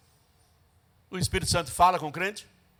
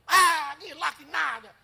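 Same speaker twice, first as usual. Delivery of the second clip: scornful retort; 7 semitones higher than usual. Retold or imitated. Woman scoffing, storming off.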